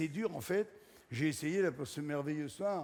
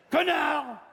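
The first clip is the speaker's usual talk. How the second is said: higher, louder mock snap of an insult; shouted insult; 8 semitones higher, 5 dB louder than usual. Comedian to the audience, shouted mock insult. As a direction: fling the word out as a punchline.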